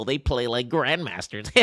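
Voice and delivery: silly mock-chatter voice. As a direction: imitate a complaining beginner, stretched out. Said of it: "little shitheads who are like four hundred elo".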